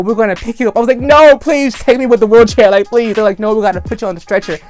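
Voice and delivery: ordinary delivery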